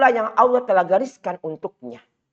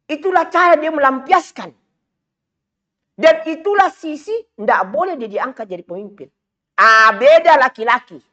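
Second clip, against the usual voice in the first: raised, loud sermon voice; rising preacher's fervour; fiery preaching; 8 semitones higher, 5 dB louder than usual. Acted. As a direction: preach it out high and hard to the crowd. Preaching at full force.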